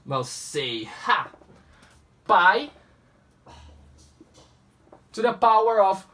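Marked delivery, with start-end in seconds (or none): none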